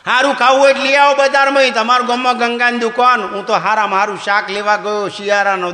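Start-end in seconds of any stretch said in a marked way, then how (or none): 0.0-3.2 high, loud retort in the wife's voice; wife tells husband to buy better vegetables, loud and high; wife snapping back. Retold or imitated. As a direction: give it right back to him, laughing. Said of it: "મને કે".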